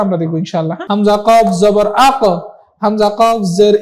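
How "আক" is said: আক with a short echoing bounce on the final q; qalqalah bounce. Shown as a demonstration. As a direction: push the end of আক so the q rebounds. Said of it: "এই যে একটা ধাক্কা দিচ্ছি".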